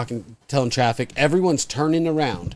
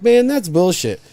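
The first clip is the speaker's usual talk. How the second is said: higher indignant complaint; mild protest; 6 semitones higher, 4 dB louder than usual. Retold or imitated. Driver protesting the guard's refusal, mildly indignant. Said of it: "I'm like".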